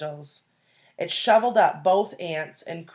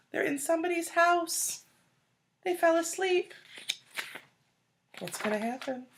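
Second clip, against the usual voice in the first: high suspenseful voice; 11 semitones higher than usual. Acted. Teacher builds suspense for kids.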